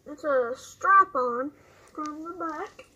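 muffled behind face mask; speaking inside full respirator; through a gas mask. Real, not acted. Seen 1: Speaker wears full-face respirator mask throughout while talking; R frames only hold it.

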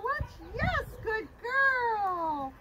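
slow, stretched-out happy praise. Shown as a demonstration. Trainer showing the happy voice to praise the puppy. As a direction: draw it out warmly for the puppy. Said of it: "Happy voice, you guys".

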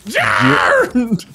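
shouted Jammed, loud and drawn out. Shown as a demonstration. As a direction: bellow the word Jammed. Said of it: "Say it louder".

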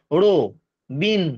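রো and বিন as separate syllables. Shown as a demonstration. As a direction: pause between রো and বিন. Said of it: "সেই অনুযায়ী ওটাকে আমি দল করব".

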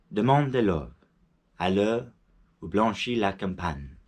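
poem recital. Shown as a demonstration. Reading the poem out line by line before translating, solemn. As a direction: declaim the verse as a recital.

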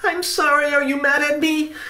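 very high, small pleading voice; apologetic boy. A narrator's reading. Benny asks if uncle is mad, high pleading voice. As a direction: plead like a worried child.

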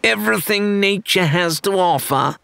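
high mocking echo of her words; mocking parrot. A narrator's reading. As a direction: parrot her back to needle her. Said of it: "Rathbone parroted".

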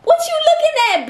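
high, fast taunting snap; angry. Real, not acted. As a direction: snap at the winner. Monopoly taunting rant.

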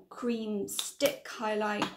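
ordinary talk

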